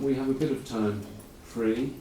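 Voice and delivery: normal talking voice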